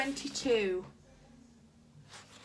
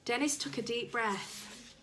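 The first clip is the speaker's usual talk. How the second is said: usual speaking voice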